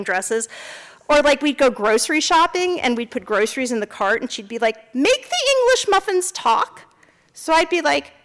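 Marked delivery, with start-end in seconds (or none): 4.9-6.8 put-on high demanding kid voice; mother voicing her little daughter, high and demanding; little girl voice. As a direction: ask like a small child.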